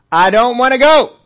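put-on high, very loud childish refusal; defiant outburst. Churchgoer finally refusing to go, defiant shout. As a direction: burst out yelling the refusal. Climax of the acted excuses.

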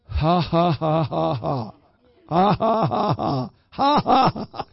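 deliberate ha ha to prime laughter; forced laughter. Shown as a demonstration. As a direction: pump out laughs to get it going. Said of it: "prime your pump".

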